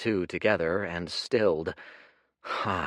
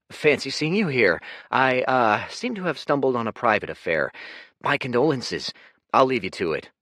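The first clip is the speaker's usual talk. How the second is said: breezy rogue; 6 semitones higher, 6 dB louder, faster than usual. Acted. Dorian bluffing his way out.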